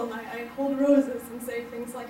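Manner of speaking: usual speaking voice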